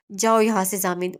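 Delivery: usual speaking voice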